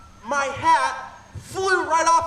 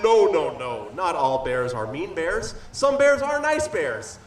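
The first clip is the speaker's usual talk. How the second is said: bear voice; 9 semitones lower than usual. Acted. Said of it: "And it said".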